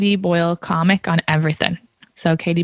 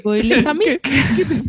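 slow, higher French "Comique", last syllable drawn out; mock French accent; 5 semitones higher, slower than usual. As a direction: play the name up as French. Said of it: "like I'm a fucking French person".

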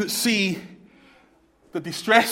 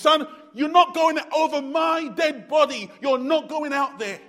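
higher, louder defiant mother; mother's defiance; 9 semitones higher, 4 dB louder than usual. Retold or imitated. Mother blocking the door against her son, raised and defiant. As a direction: plant your feet and refuse him. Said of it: "And she said".

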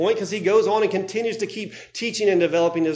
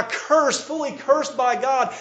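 ordinary delivery

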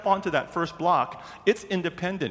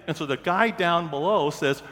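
usual speaking voice

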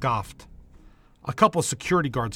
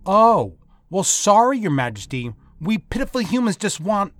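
higher, louder mock apology; sarcastic; 9 semitones higher, 7 dB louder than usual. Nurse mocking the woman who talks down to her, sarcastic. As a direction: throw her title back at her with sarcasm. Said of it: "getting tired of being talked down to".

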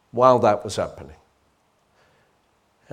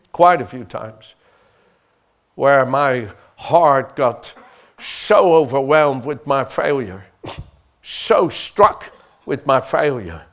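louder and higher, rising to a shout; from fervent to shouting preacher; fiery preaching; 5 semitones higher, 9 dB louder than usual. Acted. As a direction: build the sermon to full force. Preaching builds to full force.

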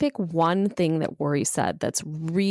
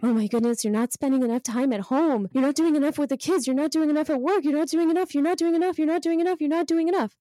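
higher nagging inner critic; worry voice; 8 semitones higher, faster than usual. Acted. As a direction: nag yourself over and over. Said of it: "My worry voice is not as funny as yours".

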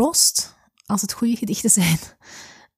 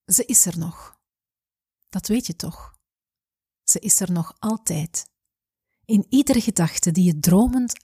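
poem reading. Real, not acted. Reads a poem aloud. (gedicht voorlezen, ingetogen en rustig)

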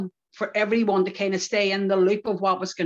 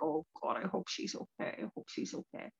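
worried whisper, much softer; anxious whisper; 13 dB quieter than usual. Retold or imitated. Praying for Sinead as guards take her away, in a worried whisper. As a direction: whisper it under your breath, fearing for her.

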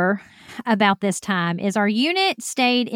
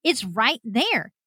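high-pitched mock yell; retold yelling; 6 semitones higher, slower than usual. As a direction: act out frantic pointing-it-out cries. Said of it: "yelling at them and pointing".

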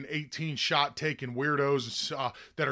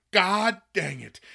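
mock curse; 5 semitones higher, 6 dB louder, slower than usual. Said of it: "it's like".

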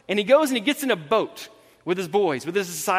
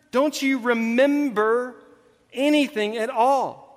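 drawn out word by word, pitched up; slow exasperation; 4 semitones higher, slower than usual. Retold or imitated. Jesus prodding forgetful disciples, slow exasperation. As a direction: labour every word in acted frustration. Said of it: "wanting to pull his hair out".

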